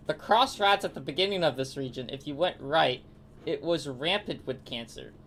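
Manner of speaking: ordinary talk, nothing marked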